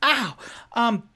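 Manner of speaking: high, loud, stammering panic; Charlie Day impression. Tipsy insisting to the halfling woman, high and frantic. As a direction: babble it out, tripping on every word. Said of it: "his best Charlie Day impression".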